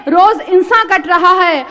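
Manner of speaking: measured Urdu verse, each phrase projected; poetry recital. Acted. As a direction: declaim the couplets to an audience. Poet recites ghazal to audience.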